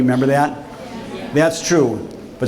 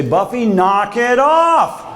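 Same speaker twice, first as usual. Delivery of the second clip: scolding; 9 semitones higher, much slower than usual. Retold or imitated. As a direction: scold the bear like you mean it. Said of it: "I'd scold him and say".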